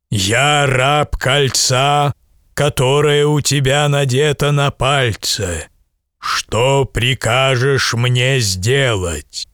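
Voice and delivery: drawn-out spirit of the ring; genie. Acted. Giant genie speaks to boy.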